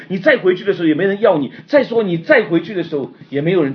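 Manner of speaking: loud, high, driving sermon delivery; forceful preaching. Acted. Preacher urging the church about leaving everything, loud and intense. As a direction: press each point hard on the congregation.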